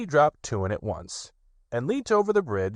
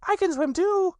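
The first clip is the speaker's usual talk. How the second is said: very high, proud boast; proud little Roo. A narrator's reading. Little Roo boasts to Eeyore, high and proud. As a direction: show off like a little one. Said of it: "said Roo proudly".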